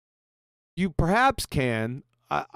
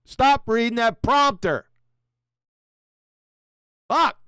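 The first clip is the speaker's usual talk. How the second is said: higher-pitched, fed-up yell; exasperated outburst; 6 semitones higher, 5 dB louder than usual. Real, not acted. Reactor scolding the video presenter for prompter reading, exasperated outburst.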